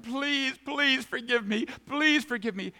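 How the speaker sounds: very high, drawn-out mock plea; hammed-up begging. Sinner coming to God with sins, hammed-up begging. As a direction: beg desperately in an exaggerated high voice. When we are saying.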